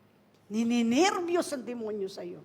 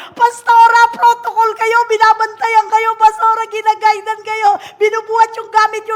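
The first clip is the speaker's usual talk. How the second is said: high, loud insistent usher calling out; shouting usher; 10 semitones higher, 16 dB louder than usual. Retold or imitated. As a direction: shout over the crowd to the pastor. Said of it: "Sumisigaw yun".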